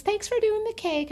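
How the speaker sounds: high, bubbly imitation of the happy bride; gushing bride. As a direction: gush like a thrilled newlywed. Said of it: "And she goes".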